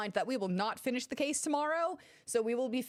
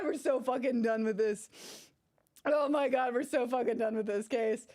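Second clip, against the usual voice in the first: usual speaking voice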